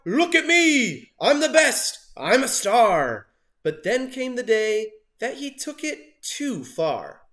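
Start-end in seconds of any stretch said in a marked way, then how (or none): 0.0-3.3 high, loud, slow bragging; show-off shout. A narrator's reading. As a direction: crow it to the whole room. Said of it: "He'd shout".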